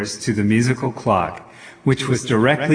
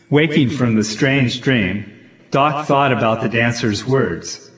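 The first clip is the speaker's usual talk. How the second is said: ordinary delivery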